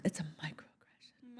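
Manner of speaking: breathy stage whisper. Acted. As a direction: whisper it like a secret. Repeats the joke in a whisper.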